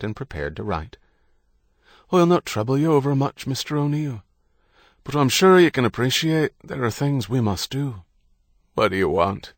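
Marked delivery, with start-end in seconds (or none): 2.1-8.0 higher-pitched Irish policeman, politely firm; officer's mellow tenor. A narrator's reading. Police officer beginning to question a patient, mellow tenor. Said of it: "He had a mellow tenor". 8.7-9.5 a touch of a croak; lightly croaked question, as if groggy. A narrator's reading. Patient answering the officer from his bed, slightly croaky. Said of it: "John's voice remained a croak".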